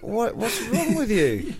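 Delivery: high slow incredulous squeak; high mock exasperation. Reacting to the whiny rabbit, high slow mock disbelief. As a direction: sound baffled and pinched. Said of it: "It's like".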